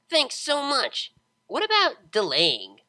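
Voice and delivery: loud, slow, sing-song question; cheery pegasus. A narrator's reading. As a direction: drag out the question happily. Pegasus keeps pestering.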